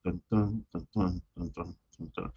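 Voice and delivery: soft muttered "dun" beats counted out; quiet beat counting. Shown as a demonstration. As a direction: count the ten "dun" beats under your breath. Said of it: "And then ten syllables".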